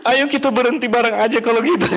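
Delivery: high, loud mock weeping along; joining the crying. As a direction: sob along with her, half laughing. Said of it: "mau ikut nangis juga". (upline ikut menangis bersama downline)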